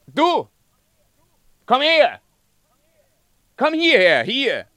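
king shouting at a distant woodcutter to come. Acted. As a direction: yell to someone far away. King calls the woodcutter over.

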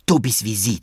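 plain reading voice

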